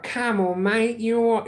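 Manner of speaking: high-pitched put-on Cockney; Cockney accent. Shown as a demonstration. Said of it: "Cockney accent, mate, is like".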